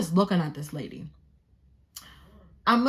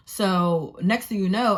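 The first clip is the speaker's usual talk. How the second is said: ordinary talk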